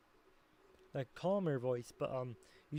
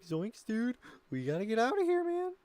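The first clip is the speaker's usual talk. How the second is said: cartoon stoner alarm, rising high; Shaggy; 8 semitones higher, 5 dB louder than usual. Shown as a demonstration. Streamer retrying a stoner hippie impression, rising high alarm. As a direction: start near usual pitch, climb into high panic. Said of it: "could be a little bit lower in tone".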